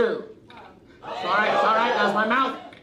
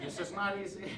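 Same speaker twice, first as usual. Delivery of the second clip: much softer, muttered to self; quiet aside; 12 dB quieter than usual. Real, not acted. Stuck in a racket, muttering quietly to himself. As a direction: mutter it under your breath.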